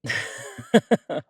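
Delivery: loud laugh jumping up high; amused laugh. Acted. Teased over mountain brunch, amused laugh. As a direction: burst out laughing.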